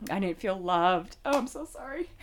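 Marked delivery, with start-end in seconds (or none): 1.2-2.1 higher, crying voice, apologizing; a real tearful apology, voice breaking high. Real, not acted. Apologizing to a friend for crying, tearful and high. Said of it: "don't ever apologize for your feelings".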